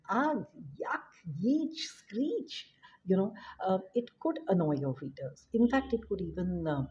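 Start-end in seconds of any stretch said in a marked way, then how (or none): 0.1-2.7 "Ugh, yuck, yeech, screech" climbing much higher, hammed-up; mock disgust noises. Shown as a demonstration. Teacher mocking overused exclamations, high hammed-up disgust. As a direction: toss off silly disgust exclamations. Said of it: "go easy on exclamation like".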